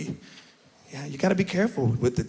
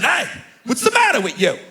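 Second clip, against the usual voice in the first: upset Italian dad; 10 semitones higher, 3 dB louder than usual. Retold or imitated. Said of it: "letter and ask a question".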